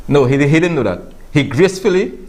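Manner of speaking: usual speaking voice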